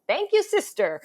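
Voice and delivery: grateful cry. Retold or imitated. Grateful to scene partner.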